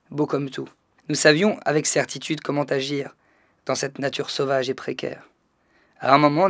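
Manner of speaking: measured, subdued poetry recital with pauses between phrases; poem reading. Acted. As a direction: read your poem aloud evenly, letting each phrase settle. Reads his new poem aloud. (lecture de poème, posée et retenue)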